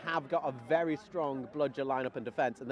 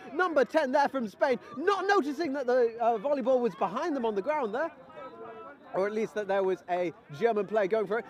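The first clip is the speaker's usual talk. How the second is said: play-by-play; 6 semitones higher, 4 dB louder than usual. Acted. Excited live commentary.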